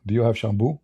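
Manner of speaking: ordinary talk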